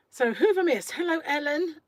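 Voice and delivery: ordinary talk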